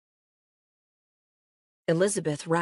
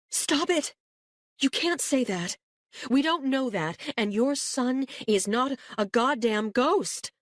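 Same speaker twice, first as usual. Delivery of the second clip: angry mother; 8 semitones higher than usual. A narrator's reading. Janice's outburst at Elizabeth.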